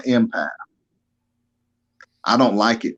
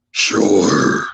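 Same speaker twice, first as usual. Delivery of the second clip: deep gravel voice; 3 semitones lower than usual. Retold or imitated. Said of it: "one of the deepest voices".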